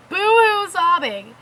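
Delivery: normal talking voice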